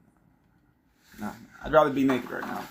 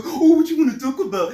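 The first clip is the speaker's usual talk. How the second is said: high, loud, bright pet voice; cooing pet-owner voice; sweet baby talk to a dog; 14 semitones higher, 9 dB louder than usual. Retold or imitated. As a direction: gush at the dog like it's a baby. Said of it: "Some people".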